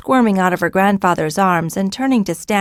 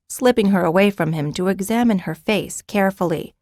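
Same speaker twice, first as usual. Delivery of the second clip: ordinary delivery, nothing marked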